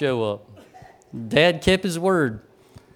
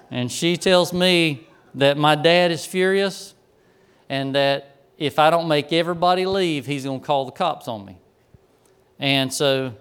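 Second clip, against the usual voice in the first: usual speaking voice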